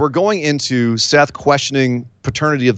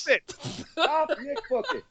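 high cracking wail, hammed up; shrill-free mock cry of no; mock anguished plea. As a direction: beg him to stop in mock despair. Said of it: "pleading with co-host, voice cracking".

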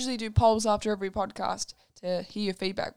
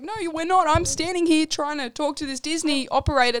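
higher, louder frustrated insistence; exasperated protest; 6 semitones higher, 5 dB louder than usual. Retold or imitated. Twin protesting to the Disney operator, exasperated and loud. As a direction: plead with the operator, fed up. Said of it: "And we're like".

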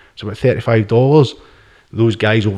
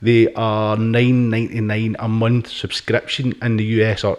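ordinary delivery

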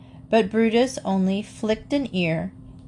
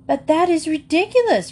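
higher, indignant protest; 9 semitones higher, 3 dB louder than usual. A narrator's reading. Mamma protests to the ladies about her hogs, indignant and higher. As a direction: push back, defending the family honor. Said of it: "protested Mamma".

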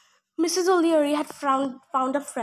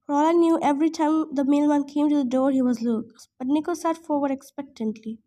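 ordinary delivery, nothing marked

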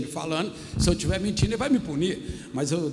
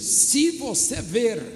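slow, high, drawn-out declaiming; solemn preaching declamation; 5 semitones higher, slower than usual. Acted. Pastor voicing Elijah's answer to Elisha, slow solemn declamation. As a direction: proclaim the condition phrase by phrase.

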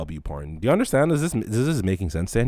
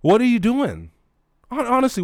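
high, loud disbelief; what on earth, thrown high; incredulous; 13 semitones higher, 6 dB louder than usual. Real, not acted. Asking the woman in disbelief, high and loud.

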